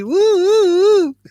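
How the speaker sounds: high, loud "woo woo"; cheeky high-pitched hooting; playful whoop. Real, not acted. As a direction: let out a saucy rising whoo-hoo. Playful hoot after naming sexuality.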